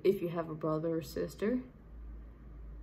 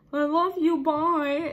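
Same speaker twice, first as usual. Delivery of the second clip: loud, high imitation of YouTubers; mock YouTuber sign-off; 7 semitones higher, 7 dB louder, slower than usual. Vlogger imitating YouTubers' goodbyes she hates, loud high mockery. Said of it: "when YouTubers say".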